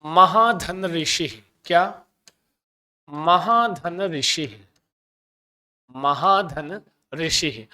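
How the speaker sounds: महाधन ऋषि said slowly, each syllable drawn out and separated; slow, deliberate classroom enunciation of महाधन ऋषि; slow spelled-out example. Shown as a demonstration. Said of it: "जैसे कहते हैं".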